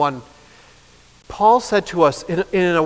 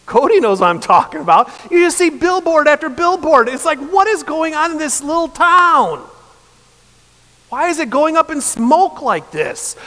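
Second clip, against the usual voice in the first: much higher, louder incredulous outburst; exasperated rant; 8 semitones higher, 3 dB louder than usual. Real, not acted. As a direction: rant in disbelief at the town. Exasperated rant about Lowell.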